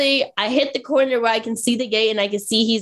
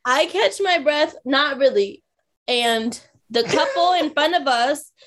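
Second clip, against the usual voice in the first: ordinary talk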